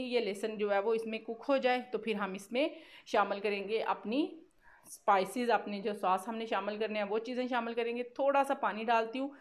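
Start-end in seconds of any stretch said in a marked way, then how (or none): none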